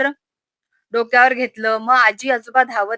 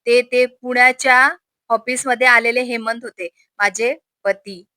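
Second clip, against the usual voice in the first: ordinary delivery, nothing marked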